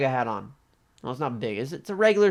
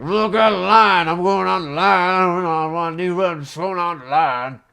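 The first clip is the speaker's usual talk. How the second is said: impatient customer; 5 semitones higher, 9 dB louder than usual. Retold or imitated. Old customer demanding a new register, loudly impatient. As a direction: bark it like a pushy customer. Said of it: "He's like".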